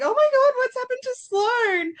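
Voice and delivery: high and loud, half-acted panic; replaying her shock at the ending; mock alarm. Retells reaction to cliffhanger.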